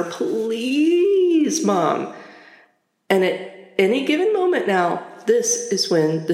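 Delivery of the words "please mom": long, high, whiny "please" falling into "Mom"; hammed-up sorrowful kid's plea; sorrowful begging. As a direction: stretch the plea out pitifully. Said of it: "the most sorrowful face ever".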